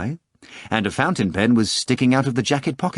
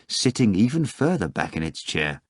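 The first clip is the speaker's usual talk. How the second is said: usual speaking voice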